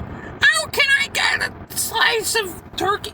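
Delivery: very high, slow mocking old-lady voice; bird-like old woman. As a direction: imitate a squawky old bird of a customer. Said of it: "she sounds like a bird".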